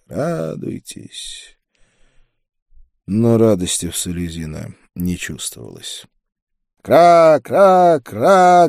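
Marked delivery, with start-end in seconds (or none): 0.1-1.6 high, soft, long-drawn distant cry; a desperate call heard from far down the street; muffled desperate cry. A narrator's reading. Woman's cry reaching the rider leaving the street, muffled and desperate. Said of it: "донёсся приглушённый, отчаянный крик". 6.9-8.7 loud, high raven cawing; guttural crow caws. A narrator's reading. Crows calling from sloping city roofs, loud guttural caws. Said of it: "гортанно кричали вороны".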